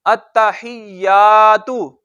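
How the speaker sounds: अत्तहियातु very slow, tt held, long या; careful recitation of अत्तहियातु with the tt held. Shown as a demonstration. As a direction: stretch each syllable of अत्तहियातु, holding the doubled t. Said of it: "और इसको".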